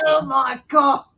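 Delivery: loud slow shout. Real, not acted. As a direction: belt out slowly. Shouted reaction.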